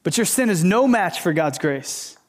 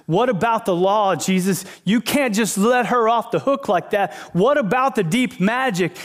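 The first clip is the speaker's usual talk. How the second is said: raised pitch, insistent objection; worked-up, higher-pitched mock challenge; protesting Pharisee; 5 semitones higher than usual. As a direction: imitate an official protesting a broken rule. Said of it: "this Pharisee goes to Jesus and goes".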